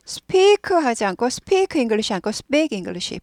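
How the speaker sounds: "speak" first with a breathy p, then a tense, b-like p; slow demo of "speak English" with p near b; speak contrast. Shown as a demonstration. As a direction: speak 된소리 대조 시범. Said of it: "P가 아니라 B 발음이 나요".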